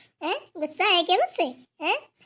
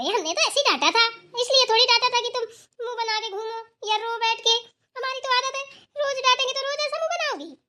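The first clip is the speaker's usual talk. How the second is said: playful scolding; 6 semitones higher than usual. Acted. Grandmother coaxing sulking girl.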